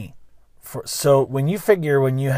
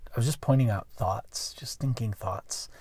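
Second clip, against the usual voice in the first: whispering; 3 semitones lower, 11 dB quieter than usual. Real, not acted. Backpedalling on being mean.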